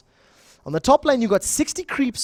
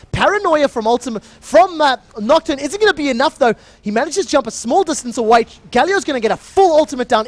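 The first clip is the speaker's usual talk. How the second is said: excited play-by-play; 4 semitones higher, 4 dB louder than usual. Acted. Live play-by-play of fight.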